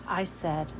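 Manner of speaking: ordinary delivery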